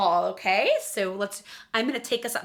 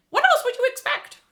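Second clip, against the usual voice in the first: squeaky cartoon voice; 8 dB louder than usual. Jokes in the Disney voice.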